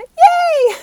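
high, loud squealed yay; idol's cheery yay, imitated; excited yay. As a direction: squeal it with delight. Idol's cheerful reply retold.

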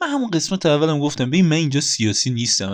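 usual speaking voice

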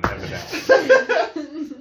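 high, slow laughing drawl. Real, not acted. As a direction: stretch it out through laughter. Self-mocking admission.

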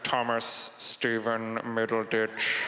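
low, very slow, drawn-out drawl; slow, ominous British professor; Snape. Acted. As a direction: stretch every word out in a low monotone. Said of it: "I gotta find Snape's voice".

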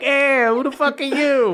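high, hammed-up Boston tough guy; Tom Brady. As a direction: pick a mock fight in broad Boston. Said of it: "Just imagining Tom Brady being like".

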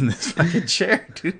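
laughing through the words. Real, not acted. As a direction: crack up while telling it. Laughing at the punchline.

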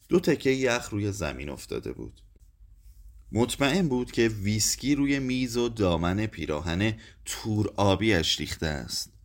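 usual speaking voice

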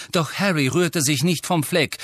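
usual speaking voice

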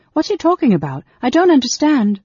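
high, loud, frustrated protest; agitated youth demanding answers; bewildered and angry. A narrator's reading. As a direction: burst out in baffled annoyance. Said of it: "bewildered and angry himself".

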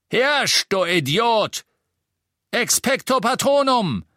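high, loud scornful shout; thundering. A narrator's reading. Innkeeper casting his Patronus at doubters, thundering. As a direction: roar the insult, then the spell. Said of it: "donnerte der Wirt".

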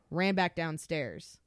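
ordinary delivery

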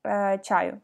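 ordinary delivery

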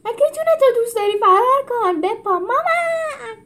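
high loud frantic yell with a laugh; panicked crowd cry. A narrator's reading. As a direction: shout in chaos. Crowd voices echoing.

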